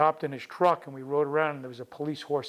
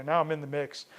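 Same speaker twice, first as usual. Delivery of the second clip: ordinary delivery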